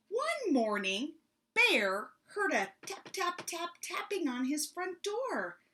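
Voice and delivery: put-on lively read-aloud for children; story narration. A narrator's reading. Librarian reading a picture book to children, lively. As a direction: tell it to a room of kids.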